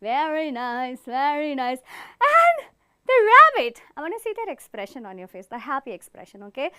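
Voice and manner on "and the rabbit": high, loud, slow gasp; surprised. Acted. Teacher acting surprise at a rabbit in the forest. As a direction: startle at the rabbit.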